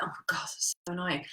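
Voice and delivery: soft unvoiced whisper to herself; whispered aside. Real, not acted. Host annoyed at her screen, whispering under her breath. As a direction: breathe it as a private gripe.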